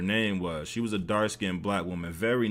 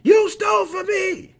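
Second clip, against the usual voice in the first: very high, screeched accusation; hammed-up full-voiced screaming; yelling at top of lungs; 6 dB louder than usual. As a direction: imitate a man hollering hysterically. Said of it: "kept yelling at the top of his lungs".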